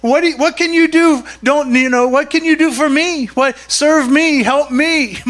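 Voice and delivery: high, insistent demanding; self-centred king. Acted. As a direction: demand service like an entitled king. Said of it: "When you're the king, it's not about the other people".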